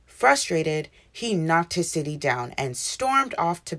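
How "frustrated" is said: plain reading voice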